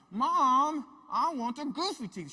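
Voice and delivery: loud, high whiny demand; little girl loudly demanding the goofy teeth; loud demanding child. Retold or imitated. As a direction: yell it like a stubborn kid.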